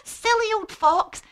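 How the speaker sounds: high, teasing mouse voice; amused mouse. Acted. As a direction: scoff playfully at the fox. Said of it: "the mouse thought this was so funny".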